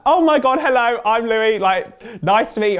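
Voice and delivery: high, loud, gushing hello; an eager newcomer gushing hello to the singing teacher; over-the-top excited greeting. Retold or imitated. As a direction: burst in far too eager and bright. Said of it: "it would have been so bad as well".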